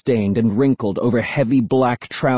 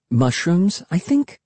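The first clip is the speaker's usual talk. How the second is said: plain reading voice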